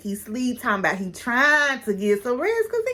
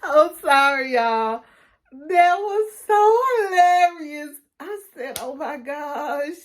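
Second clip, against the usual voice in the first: laughing hard; 5 semitones higher, slower than usual. Real, not acted. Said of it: "I was weak".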